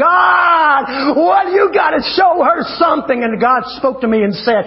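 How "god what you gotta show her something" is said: hammed-up wailing cry, higher pitch; mock boo-hooing. Preacher retelling his crying prayer on the bed, mock wailing shout. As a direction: cry out in exaggerated desperation. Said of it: "I'm boo-hooing and crying".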